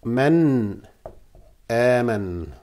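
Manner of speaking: "Man aman" with the noon said clearly, soft and low; tajweed demo. Shown as a demonstration. Teacher showing students how to say "Man aman" clearly. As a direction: spell out "Man aman", the noon sounded in full. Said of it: "we'll say it an".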